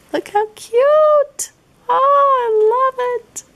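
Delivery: high squeaky gush. Real, not acted. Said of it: "I know, squeaky voice".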